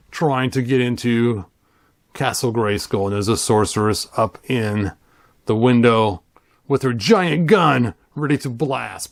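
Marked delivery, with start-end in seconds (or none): none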